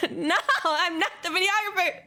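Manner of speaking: mock-indignant, breaking into high laughter; laughing protest. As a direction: whine the denial through laughter. Laughing indignant denial, mistaken for staff.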